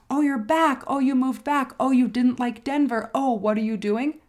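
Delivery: a hint of gossipy surprise; curious acquaintances. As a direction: play the nosy friends who pepper her with questions. Californians quizzing her on return.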